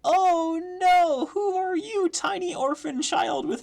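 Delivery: very high, loud squeal; gushing dismay. Real, not acted. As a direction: coo loudly over a pitiful little orphan. Gushing over orphan child character.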